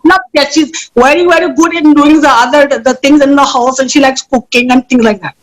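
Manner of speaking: Indian accent, domestic mother; Madam Patel. Shown as a demonstration. Actor introducing a domestic goddess, Indian accent. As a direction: speak as an Indian mother in Lagos. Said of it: "I do a very mean Indian accent".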